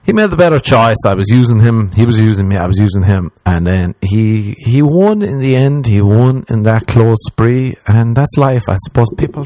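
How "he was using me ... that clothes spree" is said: ordinary talk, nothing marked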